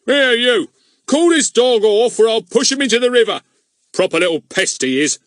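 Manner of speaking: loud, high furious yell; angry policeman. A narrator's reading. Policeman yelling at Fatty about the dog, furious. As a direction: threaten them over the dog.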